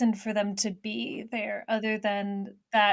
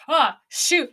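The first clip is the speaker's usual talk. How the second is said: high, loud startled exclamation; alarmed cry; 6 semitones higher, 8 dB louder than usual. Retold or imitated. Tim spotting the dragon flying at the boat, startled yelp.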